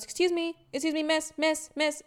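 high, lightly voiced old lady calling out; mock old lady calling. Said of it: "And then she goes".

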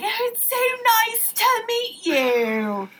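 high, slow, gushing greeting; a high, drawn-out fake-posh hello; fawning stepsister. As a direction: simper at the waiter. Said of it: "and is like".

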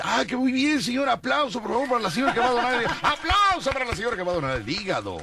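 high, fast barker patter; hyped fairground barker. Retold or imitated. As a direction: rattle it off like an MC. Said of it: "ya dice".

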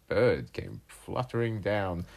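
plain reading voice

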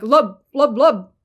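loud, punched mock barks; funny dog bark. Shown as a demonstration. Writing teacher imitating a dog with a funny bark, loud comic barks. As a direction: bark the word like a silly dog. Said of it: "funny bark and he was like".

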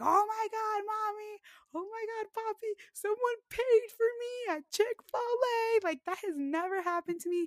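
very high, loud, gushing squeal; squealing excitement. Retold or imitated. Telling Mommy and Poppy about the free meal, squealing high. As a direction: burst in on your parents bursting with joy.

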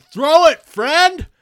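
loud, high call across the field; outfield yell. Retold or imitated. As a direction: bellow it from far away. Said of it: "yelling at me from the outfield".

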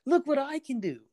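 put-on high, eager little kid showing off; excited child voice. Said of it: "All you hear is".

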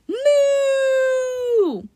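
long, high, loud moo; cow moo. Acted. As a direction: bellow a stretched high moo. Narrator voices the cow serpent.

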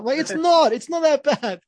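mock-hurt protest to friend mocking his car; defensive protest.

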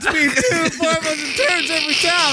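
mimicking the turd lady. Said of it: "mimicking the turd seller".

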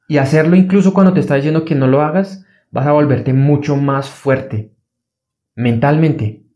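ordinary talk

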